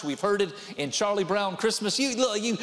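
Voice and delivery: ordinary talk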